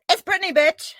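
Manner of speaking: high-pitched mock yell; Brittany's mock yell. Wrestler taunting her opponent in a face lock, yelling. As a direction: call it out like a cocky wrestling taunt. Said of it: "she yells".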